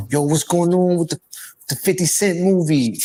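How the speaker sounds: put-on New York accent. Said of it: "I had to use my New York accent".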